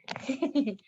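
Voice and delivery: short giggle; a small giggle to oneself. Real, not acted. Host giggling over a dead battery, short giggle.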